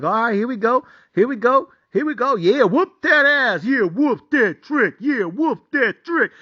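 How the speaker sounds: high, rhythmic cheering chant; building hype. Retold or imitated. Bettor cheering Colorado's drive as he relives it, hyped up.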